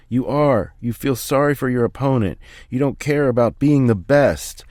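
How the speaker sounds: berating. Acted. Father berating Philly.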